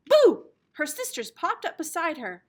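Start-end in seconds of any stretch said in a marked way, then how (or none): 0.1-0.5 loud sudden BOO; a playful fright shout; scary boo. A narrator's reading. Sisters jump out to scare.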